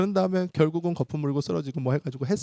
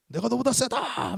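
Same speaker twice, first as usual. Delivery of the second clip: high, stretched boast without full volume; mock demon yell; 9 semitones higher, slower than usual. Demon boasts to the exorcist, high mock yell. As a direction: imitate a demon crying out defiantly. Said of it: "막 소리를 질러요".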